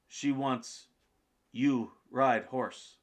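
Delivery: broken English Russian. Retold or imitated. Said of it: "broken English".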